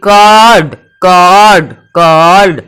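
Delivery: कर्ड drawn out as "kawrd"; O before R. Shown as a demonstration. Teacher explaining letter order, कर्ड with long aw before the r. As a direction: hold the aw of कर्ड, then bring in r and d. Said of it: "पहले ओ आएगा उसके बाद रो आएगा".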